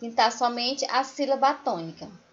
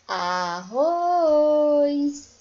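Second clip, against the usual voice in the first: "Arroz" chanted, RROZ held very long; sing-song stretch; 3 semitones higher, 3 dB louder than usual. Shown as a demonstration. Teacher repeating Arroz to prove the stress, sing-song stretch. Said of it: "falar dessa forma".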